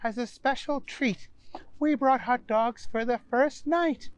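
high, polite hostess voice; Donna's voice. Acted. Donna explains the treat.

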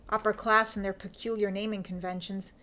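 usual speaking voice

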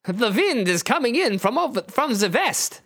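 put-on high German-accented character voice; lilting, high German accent; Nightcrawler. Nightcrawler notes the wind to Wolverine, high German accent. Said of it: "you note".

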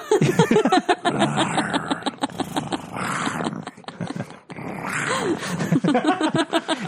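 breathless high laughter; long high laugh. Real, not acted. Laugh at cat noise.

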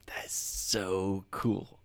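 slow hushed whisper of wonder; awed whisper. Retold or imitated. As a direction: breathe it slowly, amazed. Quoted awed reaction.

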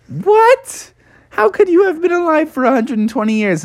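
high-pitched, fast disbelief; incredulous. Acted. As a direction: shoot up high in astonishment. Bear astonished at the claim.

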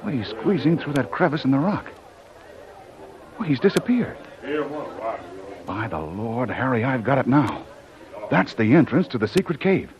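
a tracker who's just found the hideout; high, loud, excited rush of discovery. Acted. Hero tracking villain, discovers cave.

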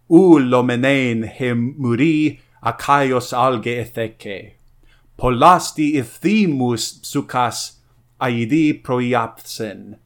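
slow, measured Homeric recitation; recitation of Greek. Shown as a demonstration. Reciting the Iliad's opening for listeners, slow and measured. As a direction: intone the Greek lines slowly, like an epic bard. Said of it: "here is this text".

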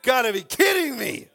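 loud, laughing disbelief; exasperated shout. Real, not acted. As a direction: shout it out in fake outrage. Mom's mailbox full, exasperated.

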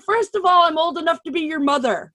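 loud, high-pitched retold protest; indignant comeback. Woman retorts to much younger suitor, indignant.

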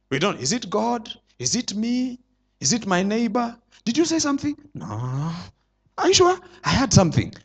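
soft, puzzled questions to yourself; a quiet, unsure inner muttering; confused murmur. Pretend. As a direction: hear voices and doubt them, hushed. Preacher acts confused listener.

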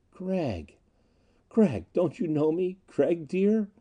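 high, gentle, softened appeal; soft pleading. A narrator's reading. Disguised Anita begs Gregg to know her, soft pleading. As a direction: plead tenderly to be recognized. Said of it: "A soft pleading".

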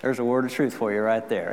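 ordinary talk

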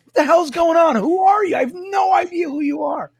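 high-pitched mock bewilderment; completely perplexed. Stranger in line baffled by the fans, imitated perplexity. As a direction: imitate a man at a total loss, nearly shouting. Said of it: "the guy was completely perplexed".

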